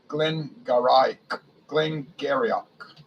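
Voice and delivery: Glengarioch sounded out slowly, as if guessing; slow tries at the name. Shown as a demonstration. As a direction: stumble slowly through Glengarioch. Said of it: "It says pronounced Geary".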